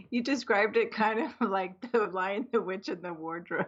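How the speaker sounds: amused, words broken up by a laugh. Real, not acted. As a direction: speak through real laughter. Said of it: "I'm laughing so much".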